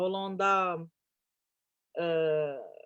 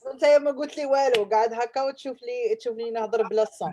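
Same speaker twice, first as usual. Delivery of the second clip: mock reproach; 5 semitones higher, 6 dB louder, much faster than usual. Real, not acted. Host teases guest after being muted.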